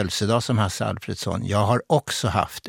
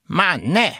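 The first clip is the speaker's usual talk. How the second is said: put-on high, swooping toddler echo; mimicking toddler; 7 semitones higher than usual. Two-year-old copying his grandmother, high childish mimicry. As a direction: parrot it like a two-year-old, much higher. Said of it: "härmade då spelevinken".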